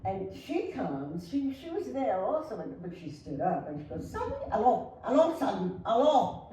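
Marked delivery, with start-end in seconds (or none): 4.1-6.5 a lighter mock call, raised and louder; imitating the wife calling him away with a laugh. Wife calling [name] away again.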